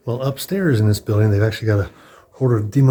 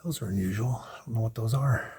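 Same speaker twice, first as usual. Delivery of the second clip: hushed whisper to himself; 10 dB quieter than usual. Real, not acted. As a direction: whisper it so nobody hears. Quiet aside looking at displays.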